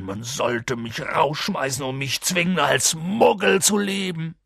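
high, tearful acted wail; distraught big man crying in remorse; sobbing. A narrator's reading. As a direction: blame yourself bitterly through tears. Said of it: "schluchzte er".

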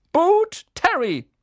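loud, high roll call. A narrator's reading. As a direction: call the name across the hall. Teacher calls out names. (laut aufgerufener Name)

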